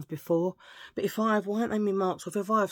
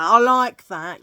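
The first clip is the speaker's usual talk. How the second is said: louder; 3 semitones higher, 11 dB louder, slower than usual. Shown as a demonstration.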